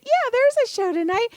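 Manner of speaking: high-pitched mock confidence; naive assurance. Telling the parking attendant there's a show, naively sure.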